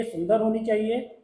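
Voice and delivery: ordinary delivery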